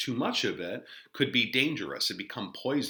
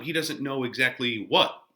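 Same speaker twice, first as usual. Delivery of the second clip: ordinary talk, nothing marked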